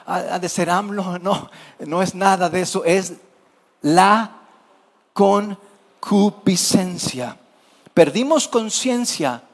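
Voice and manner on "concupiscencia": concupiscencia stretched very slowly, every syllable weighed; drawn-out key word. Acted. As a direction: let concupiscencia hang, slow and heavy. Preacher landing key word.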